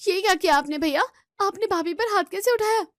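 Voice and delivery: high, loud shocked shouting; outraged shout. Acted. Sister confronting her brother for slapping his wife, outraged shout. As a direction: scream at him in outrage.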